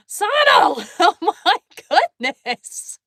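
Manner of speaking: high, loud delighted call of a friend's name; loud, high and laughing with surprise; excited shout. Real, not acted. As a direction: squeal it like you can't believe the gift. Reacting to surprise gift.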